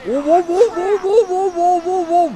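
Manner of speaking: excited ov cries. Real, not acted. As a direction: yelp as the car nearly crashes. Tense moment in race.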